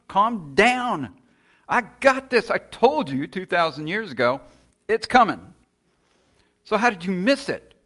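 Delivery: high, loud stern rebuke; booming exasperated God telling them off; God scolding. Retold or imitated. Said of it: "And God's going".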